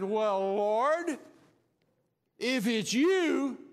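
loud, slow, drawn-out call; bold Peter calling. Acted. Peter calling out to Jesus, slow and loud. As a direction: stretch the plea across the water.

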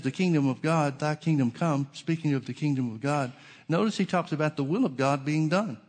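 usual speaking voice

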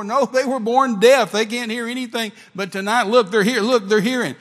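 loud, high, urgent outburst; excited screaming. Retold or imitated. Mother explaining her deaf twins now hear, loud excited screaming. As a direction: shout it high, overwhelmed by the miracle. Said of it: "she's just screaming at me".

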